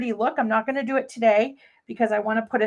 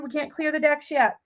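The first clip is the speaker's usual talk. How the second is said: usual speaking voice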